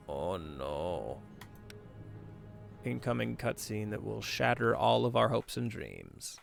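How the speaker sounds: ordinary delivery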